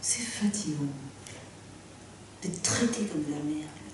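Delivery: complaining under his breath about being treated badly; bitter whisper. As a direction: whisper it to yourself, hurt.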